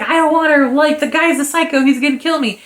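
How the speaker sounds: acted-out frantic protest, high and loud; hammed-up alarmed pleading; mock panic. Voicing villain scared of the psycho.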